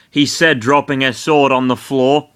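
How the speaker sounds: ordinary delivery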